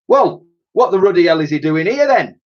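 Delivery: put-on loud, indignant old soldier; demanding. A narrator's reading. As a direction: demand an answer from them. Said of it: "he demanded".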